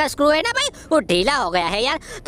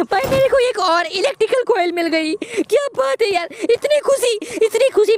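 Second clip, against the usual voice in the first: high, loud overjoyed gloating; giddy joy at a lucky find; excited; 11 semitones higher, 4 dB louder than usual. Real, not acted. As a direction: crow with delight over the find. Finds coil, overjoyed gamer.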